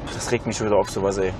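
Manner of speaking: low annoyed mutter; quietly seething to himself; grumbling under breath. Real, not acted. Grummelt über Ärger. (leise vor sich hin grummelnd)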